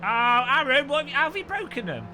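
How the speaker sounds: high, loud disbelief at the glitch; exasperated exclaim. Real, not acted. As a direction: cry out at the broken game.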